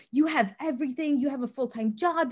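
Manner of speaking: self-pitying victim. Retold or imitated. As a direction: lay on the guilt like a victim. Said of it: "he played the victim role".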